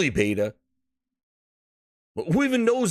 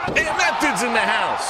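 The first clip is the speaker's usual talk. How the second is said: hype announcer call; 5 semitones higher than usual. Real, not acted. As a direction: call them in like a ring announcer. Hype intro calling out players.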